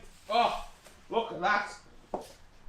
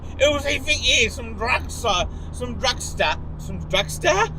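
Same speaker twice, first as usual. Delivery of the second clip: higher, louder mock voice of judging strangers; mocking onlookers; 8 semitones higher, 5 dB louder than usual. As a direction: play the gawking passers-by, a bit shrill in tone. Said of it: "probably thinking".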